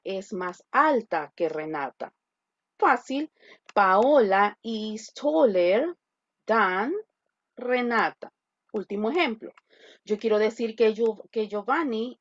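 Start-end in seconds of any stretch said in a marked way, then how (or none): 3.8-8.3 slow, drawn-out model sentence; slow dictation. Shown as a demonstration. Teacher repeating the model sentence slowly. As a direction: read it out slowly for students. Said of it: "teacher modeling sentence".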